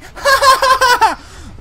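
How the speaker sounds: loud high laugh; loud high laugh at his own excuse; burst of laughter. Real, not acted. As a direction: break out laughing at himself.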